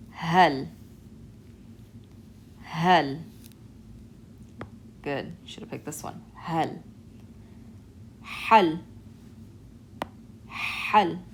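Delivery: Hal, ح rasped in the throat; raspy hal. Shown as a demonstration. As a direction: test word with throat ha. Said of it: "You hear that raspy sound?".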